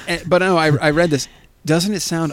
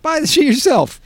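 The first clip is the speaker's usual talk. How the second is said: mock retort; 12 semitones higher than usual. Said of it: "I'm like".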